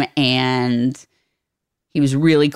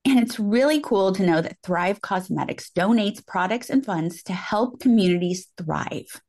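softer sponsor read; 6 dB quieter than usual. Real, not acted. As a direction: read the makeup copy gently to listeners. Reads a sponsor ad.

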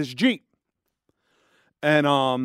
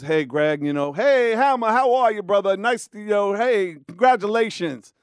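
higher, excited celebrity greeting; pitched-up friendly star voice; 5 semitones higher than usual. Retold or imitated. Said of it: "And [name] goes".

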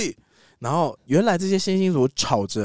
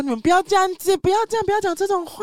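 much higher, pleading cry. Acted. San begging the ape clan to stop, high pleading. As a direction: beg them to stop in a high voice.

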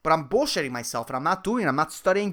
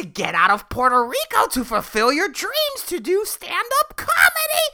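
higher taunting inner demon lecturing; voice of evil scolding; 7 semitones higher, 3 dB louder than usual. Acted. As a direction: nag him like a gloating devil. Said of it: "This fucking voice of evil".

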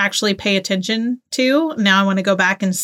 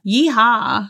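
mock yeehaw; 3 semitones higher than usual. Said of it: "they made him say things like".

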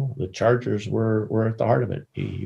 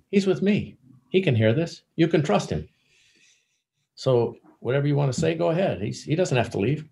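higher-pitched voice, lightly acted; retold vouching; 8 semitones higher than usual. Al tells Alex the reporter can stay, retold vouching. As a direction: vouch for him in a raised pitch.

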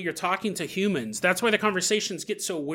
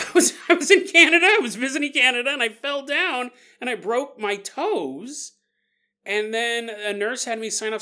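high and excited, then slowing as you tell it; lightly acted dazed soul; pretend confused soul; 6 semitones higher, 4 dB louder than usual. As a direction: play a bewildered soul remembering its fall. Imagined trapped soul recalls.

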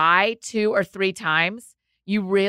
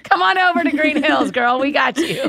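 laughing, calling her over, a bit louder; laughing invite; 5 semitones higher, 4 dB louder than usual. Real, not acted. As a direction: call her over through a real laugh.

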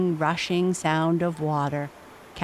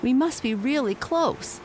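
urgent; 8 semitones higher than usual. A narrator's reading. Shouting in rapids.

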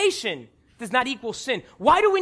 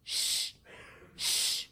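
soft breath-only shush; quiet repeated "shh"; acted shushing; 13 dB quieter than usual. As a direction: hush someone who is making a scene. Crowd hushing the beggar.

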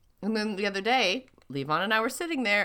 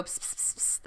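cat call hiss; 13 dB quieter than usual. Retold or imitated. Calling cats outside.

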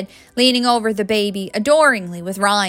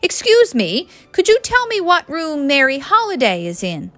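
put-on polite British accent, higher pitch; charming British accent; 7 semitones higher than usual. A narrator's reading. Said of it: "asked in a charming British accent".

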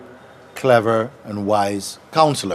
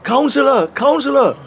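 calling out; 14 semitones higher, 5 dB louder than usual. Retold or imitated. King calls for his counselor.

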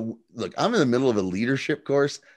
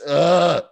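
loud, raised "ugh"; exasperated groan; 7 semitones higher, 8 dB louder than usual. Retold or imitated. Viewer reacting to another joke on the show, loud exasperated groan.

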